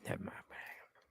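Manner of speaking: barely audible whisper; hushed, voiceless aside; whispered retreat. Acted. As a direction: whisper it as if slipping off the call. Acted caller repeats it under breath.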